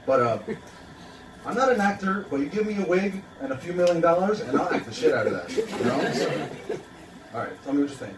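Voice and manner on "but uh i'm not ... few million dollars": usual speaking voice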